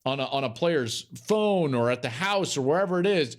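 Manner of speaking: normal talking voice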